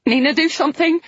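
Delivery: high frantic plea; hysterical. A narrator's reading. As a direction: beg in panic. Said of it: "the hysteria rising in my own voice".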